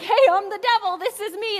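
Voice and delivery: lightly mocking a big scary devil. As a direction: boom out a cartoon devil greeting. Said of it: "this big, you know, scary version being like".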